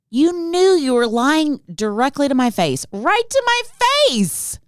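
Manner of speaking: high, escalating accusation; comic rant climbing in pitch; mock outrage. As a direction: build to a very high squeal of indignation. Ranting accusation of lying.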